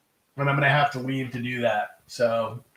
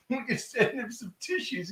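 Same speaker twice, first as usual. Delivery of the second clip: high, soft, breathless laughing voice; weak from real laughing; crying with laughter; 9 semitones higher, 10 dB quieter than usual. Real, not acted. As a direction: squeeze the words out through helpless laughter. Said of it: "crying over laughing".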